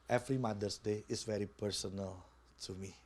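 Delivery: retelling a friend's words, hushed; quoted softly. Retold or imitated. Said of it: "Kenapa Konanda sempat bilangnya".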